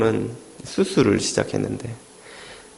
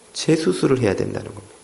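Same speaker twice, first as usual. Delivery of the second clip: usual speaking voice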